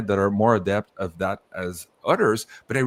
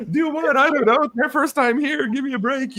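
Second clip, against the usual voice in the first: much higher, flustered protest; flustered newcomer; 4 dB louder than usual. Retold or imitated. Visitor overwhelmed by elves on first trip, high flustered protest. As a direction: plead like an overwhelmed first-timer. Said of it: "And you're like".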